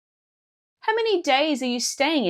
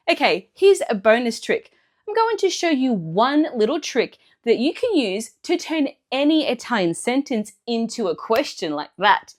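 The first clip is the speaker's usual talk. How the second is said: ordinary delivery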